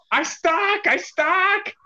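mock yell. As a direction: yell it like a running joke. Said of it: "will yell".

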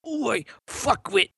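high-pitched mock Aussie exclamation; Australian accent. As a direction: throw it out in a hammed-up Australian accent. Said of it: "say it in your Australian accent".

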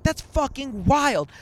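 high, loud, stretched-out astonishment; acted amazement. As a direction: re-enact being blown away, slowly. Retells amazement at coincidence.

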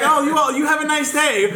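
high, sugary send-off; high, sugary fake-polite farewell; fake sweet. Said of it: "I was like".

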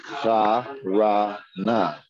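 Ha-ra-na split into slow separate syllables. Shown as a demonstration. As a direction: sound out ha, ra, na slowly.

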